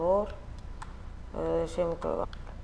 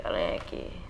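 whispered; 7 dB quieter than usual. Acted. Repeated, second whispered.

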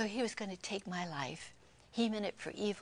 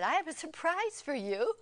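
excited announcement; 9 semitones higher, 6 dB louder than usual. Retold or imitated. Archaeologist presents painting to them.

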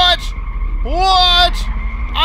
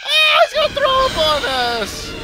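high panicked shriek; screaming in fear; 4 dB louder than usual. Real, not acted. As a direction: shriek in horror, voice cracking up high. Monster about to vomit on them.

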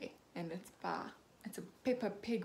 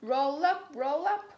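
loud fairground call, higher; loud carnival barker cry; 5 semitones higher, 8 dB louder than usual. A narrator's reading. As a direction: call the crowd in like a stall keeper. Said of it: "cries Miss Rabbit".

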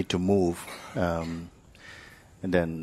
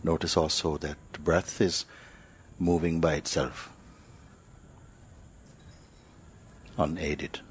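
guided meditation; 4 dB quieter than usual. Acted. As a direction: ease them into stillness, one phrase at a time. Teacher leads silent meditation.

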